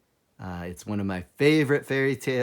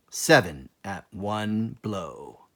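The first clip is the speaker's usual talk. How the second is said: slow proclamation; 2 semitones lower, 3 dB louder, much slower than usual. Acted. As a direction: spell out the motto grandly. Reading out the belt lettering.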